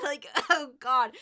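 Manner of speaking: loud, high burst of laughter; embarrassed shriek laugh. Real, not acted. Recalling the hot co-star, a loud mortified laughing shriek. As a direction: shriek at the memory. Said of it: "It's bad".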